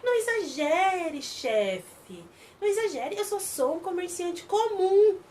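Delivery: cynical merchant. Acted. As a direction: play innocent with a sneer. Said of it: "mas é muito cínico esse homem".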